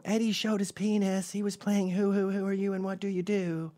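put-on high tattletale kid voice; squeaky little kids snitching; high, kiddie whine reporting a scandal; tattling kids.

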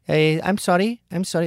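high, flustered, apologetic; apologetic caller. Retold or imitated. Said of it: "they get apologetic".

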